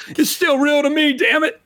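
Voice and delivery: high, hammed-up protest; mock outrage. Insisting Pluto is a planet, hammed-up high outrage. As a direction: cry out in fake outrage.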